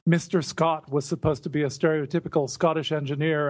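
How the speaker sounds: normal talking voice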